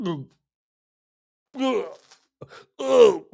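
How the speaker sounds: mock disgusted groans, loud; groaning ugh. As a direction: groan out each ugh in dismay. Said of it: "They're like".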